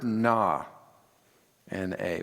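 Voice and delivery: na, then spelled N A slowly and softly; spelling out na. Shown as a demonstration. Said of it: "which means please".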